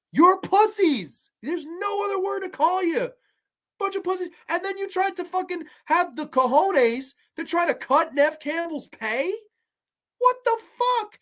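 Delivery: shrill outraged rant at the producers; high-pitched rant. Real, not acted. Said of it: "That was a rant".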